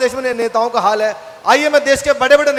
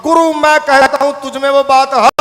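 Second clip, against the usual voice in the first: loud, slow poetic flourish; Sidhu impression; 6 dB louder, slower than usual. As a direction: declaim like a showman orator. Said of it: "नेता है नवजोत सिंह सिद्धू". (सिद्धू जी की शायराना नकल)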